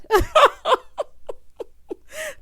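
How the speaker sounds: high, loud burst of laughter. Real, not acted. Host bursting out laughing at the stage scene, loud high laugh.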